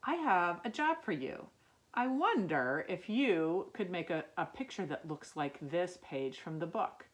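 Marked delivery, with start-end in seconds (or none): none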